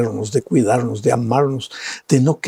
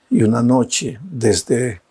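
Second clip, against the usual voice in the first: ordinary delivery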